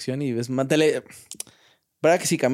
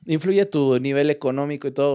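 ordinary talk

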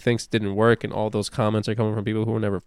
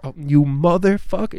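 emotional outburst; 6 semitones higher, 4 dB louder than usual. Retold or imitated. Patient's emotional outburst returns.

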